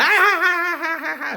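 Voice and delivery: very high, loud put-on cackle; loud "ha-ha-ha" in falsetto; mock laughing fit. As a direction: imitate a manic staccato laugh. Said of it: "he's, like, laughing".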